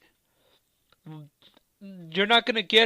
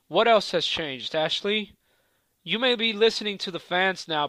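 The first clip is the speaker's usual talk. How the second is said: normal talking voice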